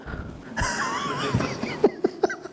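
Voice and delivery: a high squeal of a laugh; squeaky high laugh. Real, not acted. Teacher laughing at devotees who ran away, squealing. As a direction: squeal with delight at the joke.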